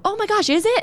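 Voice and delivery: high, surprised mock gasp; imitating an excited stranger; surprised passerby. Passerby voiced in a story.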